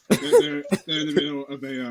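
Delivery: usual speaking voice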